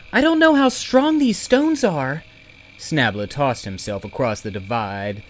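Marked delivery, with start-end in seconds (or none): 0.1-2.2 put-on worried girl voice.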